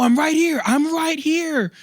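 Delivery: retold panicked calling after someone; frantic protest. Passenger calls after the car driving off, frantic.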